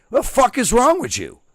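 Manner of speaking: high, loud yell of disbelief; exasperated yell. Retold or imitated. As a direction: blow up at the recruit who got it wrong. Exasperated yell at dumb recruit.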